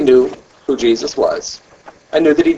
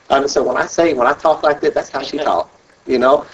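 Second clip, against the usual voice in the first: ordinary talk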